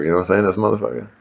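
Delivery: normal talking voice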